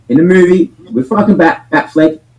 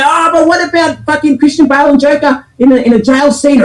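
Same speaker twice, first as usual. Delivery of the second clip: much higher whiny mock-objection voice; high sarcastic impression of a critic; mocking objector; 11 semitones higher than usual. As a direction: mimic a nitpicking fan. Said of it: "And you can sit there and say".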